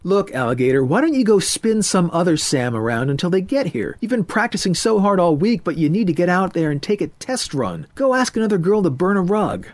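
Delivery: high, emphatic hepcat voice; jive-talking friend. Acted. Friend urges Murray to ask another girl, pushy. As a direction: egg him on as the swing-club pal.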